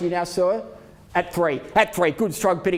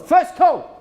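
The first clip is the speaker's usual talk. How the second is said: calling out; 9 semitones higher, 6 dB louder than usual. Real, not acted. Auctioneer calling first call.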